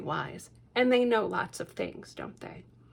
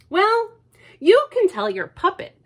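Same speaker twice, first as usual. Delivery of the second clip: much higher and louder, hearty; bluff father; 11 semitones higher, 8 dB louder than usual. Acted. Father talking down to Lucy. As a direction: boom it out like a jolly dad.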